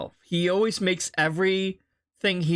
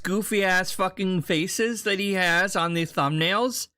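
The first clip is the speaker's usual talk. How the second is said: usual speaking voice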